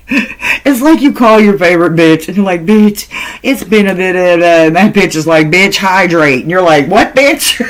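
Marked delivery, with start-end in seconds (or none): 2.7-4.4 playful gossipy friend. Acted. Acted call with best friend. 5.5-6.4 sassy friend advice. Acted. Friend's reply in acted call. 6.9-7.6 squeaky high mock-offended "What, bitch?"; mock outraged. Acted offended reaction.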